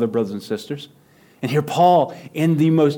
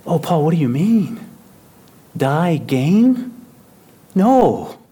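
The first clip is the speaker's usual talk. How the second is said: mock bewildered church; 4 semitones higher, 6 dB louder, slower than usual.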